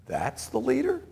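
hushed, high-pitched disbelief, rising sharply at the end; incredulous question. Acted. Onlookers doubting God's unlikely choice, incredulous question. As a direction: doubt it softly, voice climbing on LEADER. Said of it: "But someone we'd say".

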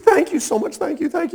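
high, gushing gratitude; overjoyed thanks. Acted. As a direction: bubble over with thanks. Overjoyed forgiven servant.

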